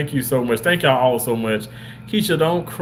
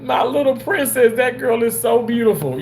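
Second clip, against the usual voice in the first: emotional gush; 8 semitones higher than usual. Real, not acted. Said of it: "don't make me cry".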